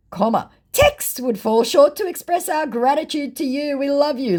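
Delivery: hammed-up fake manager; mock fake manager voice. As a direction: gush like a fawning fake manager. Reading fake manager message.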